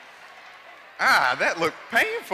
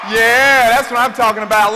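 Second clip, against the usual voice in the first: loud rally cheer; hype shout; 3 semitones higher, 12 dB louder than usual. Real, not acted. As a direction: pump the crowd up. Cheering the crowd.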